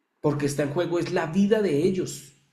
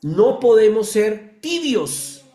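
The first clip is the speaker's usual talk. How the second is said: forceful preaching; 5 semitones higher, 7 dB louder, slower than usual. Real, not acted. Preacher exhorting congregation.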